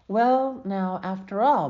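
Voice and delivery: slow down to explain it patiently. A narrator's reading. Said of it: "Mother explained".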